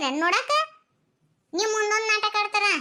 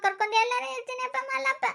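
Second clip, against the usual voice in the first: plaintive crying complaint; tearful wailing; 6 semitones higher than usual. Acted. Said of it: "ಎದಕ್ ಅಳಾಕತ್ತಿ".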